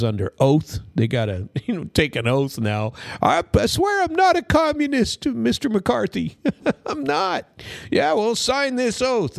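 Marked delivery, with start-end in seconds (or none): 3.2-7.5 high, hammed-up pleading voice; squeaky mock plea of the accused; mock pleading suspect. Mock communist pleading to McCarthy. 7.9-9.4 mock interrogator. Mock McCarthy accuser demanding oath.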